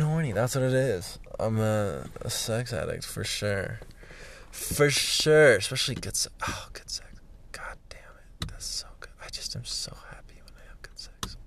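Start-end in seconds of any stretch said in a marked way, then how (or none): 4.5-5.6 "For sure" said louder and higher with a falling finish; emphatic repeat. Real, not acted. 5.6-11.4 much softer, unvoiced blissful whisper; dreamy whisper. Real, not acted. Blissful hushed aside about sex.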